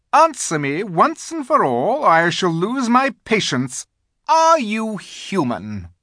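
high, loud, commanding queen; impatient imperious queen. A narrator's reading. The Queen demands Edmund answer, impatient. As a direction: order an answer, losing patience.

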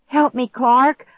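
high, loud, drawn-out plea; desperate pleading. A narrator's reading. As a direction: beg a frozen brother for help. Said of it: "I pleaded".

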